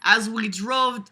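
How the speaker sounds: slow word with an accidental vocal glitch; odd voice noise. Real, not acted. Reader startled by own voice mid-sentence, odd noise. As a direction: let the voice slip oddly. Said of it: "did you hear the noise my voice made".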